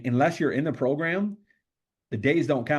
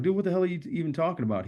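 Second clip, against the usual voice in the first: fast, higher, exasperated; man retelling his confused, heated reply, fast and higher; indignant retort; 6 semitones higher, faster than usual. Retold or imitated. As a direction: fire it back in disbelief, quickly. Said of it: "And I was like".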